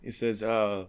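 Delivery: normal talking voice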